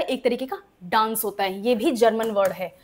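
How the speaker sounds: ordinary talk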